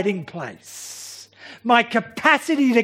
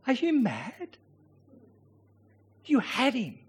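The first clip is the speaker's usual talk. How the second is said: urgent whisper-like rebuke, much softer; hushed rebuke; 3 semitones higher, 8 dB quieter than usual. Acted. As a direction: friends scolding David in a hushed voice. Friends rebuke David in the cave.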